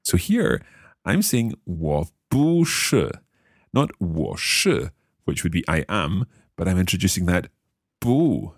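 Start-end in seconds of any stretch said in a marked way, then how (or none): none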